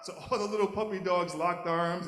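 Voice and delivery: usual speaking voice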